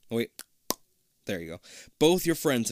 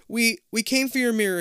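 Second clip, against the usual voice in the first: nervous stuttered start; stammering; 11 semitones higher, 5 dB louder than usual. A narrator's reading. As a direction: stutter the first word, a bit higher. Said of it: "you stammered".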